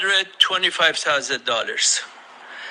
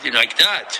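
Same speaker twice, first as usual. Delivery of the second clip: ordinary talk, nothing marked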